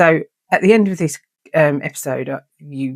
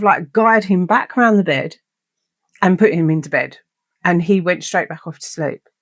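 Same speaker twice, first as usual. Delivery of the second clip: usual speaking voice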